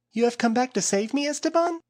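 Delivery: high, hopeful woman's voice. A narrator's reading. Flora on the ground asking for rescue, high hopeful voice. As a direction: ask it with desperate hope. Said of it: "she asked".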